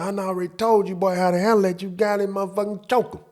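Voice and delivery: high mock voice of the stepfather egging him on. Stepfather telling the boy to fight, high hammed-up voice. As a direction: ham up the stepdad telling the boy to choke him.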